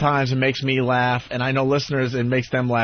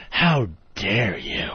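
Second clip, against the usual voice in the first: low, drawn-out mock outrage; haughty indignation; 6 semitones lower, much slower than usual. Publicists with arms folded judging the men, mock indignant. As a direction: intone it slowly like an offended onlooker. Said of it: "they have their arms folded like".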